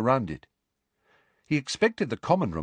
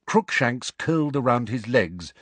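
usual speaking voice

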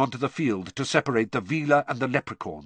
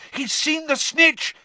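very high, drawn-out cry; excited shout; 14 semitones higher, 4 dB louder than usual. A narrator's reading. Harry to friends as the seeker dives, shouting. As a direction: shout the sighting out, slowly and high. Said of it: "Harry shouted".

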